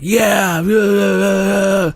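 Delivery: high-pitched mock cheering; mock reveling. Streamer imitating people who enjoy the mockery, whooping. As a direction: imitate a crowd gleefully reveling. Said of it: "Some people are really reveling in it".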